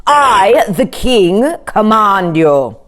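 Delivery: slow, loud royal command. A narrator's reading. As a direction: order it with full authority. King commands cow.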